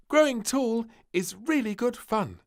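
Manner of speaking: high, loud happy voice; a girl having fun; piping glee; giggly princess. A narrator's reading. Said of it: "she giggled".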